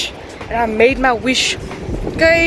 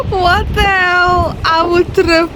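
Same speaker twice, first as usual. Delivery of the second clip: high, drawn-out disbelief; amazed exclamation; 7 semitones higher, slower than usual. Real, not acted. Amazed reaction to the view.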